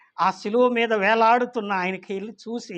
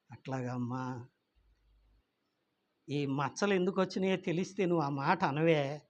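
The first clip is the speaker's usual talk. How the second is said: much softer and lower, tender reply; gentle mother; 5 semitones lower, 7 dB quieter than usual. Acted. Mother gently answers her upset daughter, soft and tender. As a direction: soothe the child softly.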